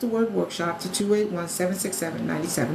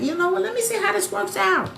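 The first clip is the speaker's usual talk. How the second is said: much higher, hesitant trial-run voice; lightly voiced customer thinking it over; doubtful customer; 11 semitones higher than usual. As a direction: play the unsure new sign-up. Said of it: "If you're like".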